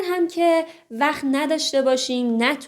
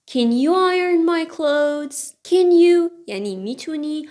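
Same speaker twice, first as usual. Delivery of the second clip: ordinary talk, nothing marked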